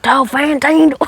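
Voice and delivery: high-pitched attempt at an Australian accent; badly faked Australian accent. Kid mimicking the AC/DC song title, badly faked Australian accent. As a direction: try an Australian accent and miss. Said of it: "I can't do an Australian accent".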